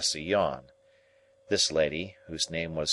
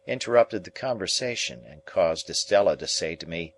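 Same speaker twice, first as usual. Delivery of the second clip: plain reading voice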